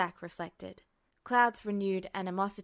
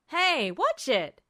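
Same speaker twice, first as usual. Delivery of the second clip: higher, louder, slow startled yelp; 6 semitones higher, 9 dB louder, much slower than usual. A narrator's reading. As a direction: yelp as a kick lands too close. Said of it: "he yelped".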